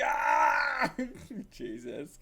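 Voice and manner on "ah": high, loud pained cry; yelp of pain. Acted. Patient having a leg straightened, high pained yelp.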